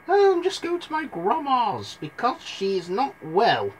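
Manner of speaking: put-on high-pitched girl voice; little girl Red. Narrator voices Red answering the wolf.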